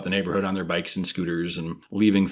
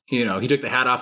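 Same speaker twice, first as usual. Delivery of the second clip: ordinary talk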